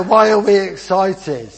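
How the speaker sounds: ordinary talk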